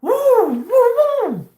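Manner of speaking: very high, loud whoops; shivery whooping. Real, not acted. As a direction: let out piercing falsetto woos. Reacts to goosebumps on skin.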